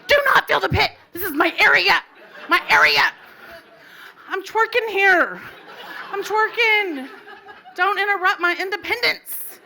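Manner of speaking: high, loud protest through laughter; laughing yell. Retold or imitated. Woman defending her dance space from the crowd, laughing shout. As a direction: holler it in mock outrage, laughing. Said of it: "And I'm like".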